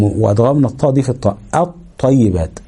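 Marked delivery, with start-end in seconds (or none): 1.5-2.6 الطيبات slow with a heavy doubled ط; الطيبات بطاء مشددة ببطء; doubled ta demo. Shown as a demonstration. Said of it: "بقت طاء مشددة".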